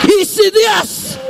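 high, very slow shout; drawn-out preaching shout. Acted. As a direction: stretch it out like a proclamation. Preaching declamation.

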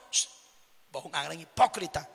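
very low, hushed mock scolding; an imagined rebuke, dropped way down; pretend scolding. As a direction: scold under your voice, deep and soft. Imagines Jesus scolding the congregation.

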